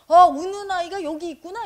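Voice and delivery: put-on the seeker realizes the crying child is right here, surprised; sudden realization. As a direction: voice the joy of finally seeing it. The moment of realization voiced.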